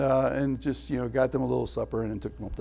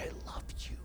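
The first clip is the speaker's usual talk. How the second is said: put-on softer whispered reassurance to a child; tender whisper; 16 dB quieter, faster than usual. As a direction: hold the child close and whisper gently. Comforting child on lap.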